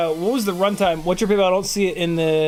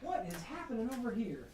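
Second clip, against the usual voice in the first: muffled from under a desk; 4 semitones higher, 18 dB quieter than usual. Real, not acted. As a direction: talk while crouched under a desk. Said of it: "I'm under the desk now".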